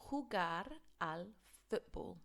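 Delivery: clearly modelled Spanish phrase; model pronunciation. Shown as a demonstration. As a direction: say it for learners to repeat. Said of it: "Repeat after me".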